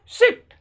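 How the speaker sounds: सिट with a short, quick "i", high and loud; short vowel. Shown as a demonstration. Teacher models the short sound, सिट said high with short "i". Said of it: "ये short sound होगा".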